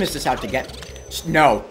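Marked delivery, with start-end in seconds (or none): none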